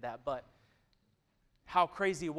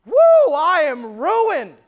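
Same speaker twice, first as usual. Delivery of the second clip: overwhelmed cry; 13 semitones higher, 22 dB louder, much slower than usual. Acted. Isaiah overwhelmed before God.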